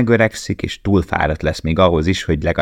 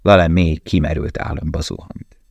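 ordinary delivery

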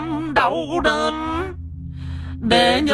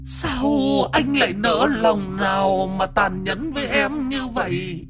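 whimpering; 9 semitones higher, 5 dB quieter than usual. Acted. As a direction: whine like a wounded lover. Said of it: "con quỷ bóng mếu máo".

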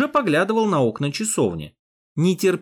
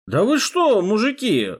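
higher, slurred fake-drunk mumble; 7 semitones higher than usual. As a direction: play a drunk, tongue tangling. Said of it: "заплетающимся языком пробормотал я".

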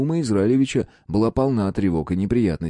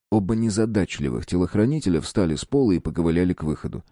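ordinary delivery, nothing marked

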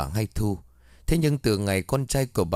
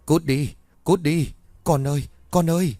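high, loud terrified screaming; half-asleep mother screaming at demons; panicked scream; 6 semitones higher, 3 dB louder than usual. Acted. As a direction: shriek in a nightmare, begging for her child. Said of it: "bà Ba Yến hét lên hoảng loạn".

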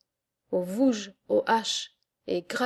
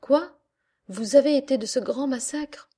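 loud, higher burst of disbelief; astonished; 4 semitones higher, 3 dB louder than usual. Acted. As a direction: react as if it can't be true. Listeners astonished at old man.

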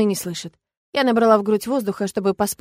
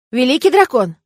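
loud, raised-pitch shout; calling out; 4 semitones higher, 8 dB louder than usual. A narrator's reading. Old man summons the dragon, shouting. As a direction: call out to him from outside. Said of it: "выкрикнул старик снаружи".

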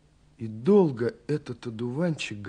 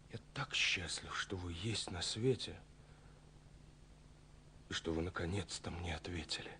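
soft, hushed, close to the ear; a near-whispered admission of happiness; tender whisper; 5 semitones lower, 15 dB quieter than usual. Acted. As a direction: breathe it out like a secret confession. Tender confession in a letter.

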